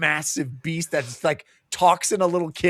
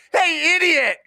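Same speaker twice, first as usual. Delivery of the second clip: shrill heckling lady; 10 semitones higher than usual. Retold or imitated. Woman in the back heckling the defender, high and jeering. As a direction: jeer at him from the back of the room.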